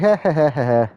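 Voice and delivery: short real chuckle at the screen; a quick breathy laugh; amused laugh. Real, not acted. Player laughs at the name.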